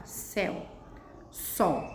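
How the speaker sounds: "Céu" e "sol" ditos cada um num só golpe, com pausa entre eles; uma sílaba só. Shown as a demonstration. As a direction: demonstre "Céu", "sol" como monossílabos isolados. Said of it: "Eu abro apenas uma vez a boca". (monossílabos bem separados)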